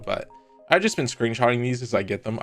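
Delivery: ordinary talk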